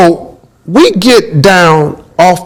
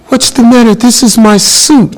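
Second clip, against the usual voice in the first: imitating [name]; 7 semitones higher than usual. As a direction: play him hurt that you'd question his outfit. [name] voices [name].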